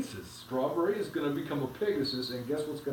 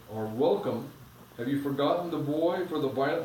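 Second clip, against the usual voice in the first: put-on steady storybook reading to the kids; reading aloud to children; 3 dB louder than usual. A narrator's reading. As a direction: read the chapter aloud, evenly paced. Parent reads a story aloud.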